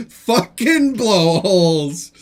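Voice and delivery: drawn-out, loud mock exclamation; loud amused quote. Streamer reads out a viewer's blowholes comment, loud and drawn-out. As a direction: relish each word, slow and loud.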